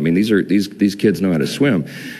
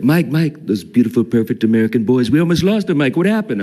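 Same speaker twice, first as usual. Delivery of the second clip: pitched way up, a hammed-up Trump; Trump impression; 5 semitones higher than usual. Speaker retells the president's call about his sons, doing his voice. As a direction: channel the president, fretting over the boys. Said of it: "I'll give you one line".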